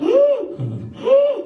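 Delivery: loud high gasp for air; winded gasp. Retold or imitated. Winded boy under bleachers, gasping for air. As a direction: suck in air like you've just been punched. Punched in gut, out of breath.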